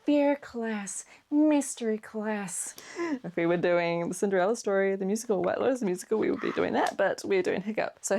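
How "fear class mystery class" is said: slow, drawn-out celebrity impression; hammed-up Coolidge-style drawl; Jennifer Coolidge. Shown as a demonstration. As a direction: stretch each word out, higher at first. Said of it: "giving more Jennifer Coolidge rather than Hiccup".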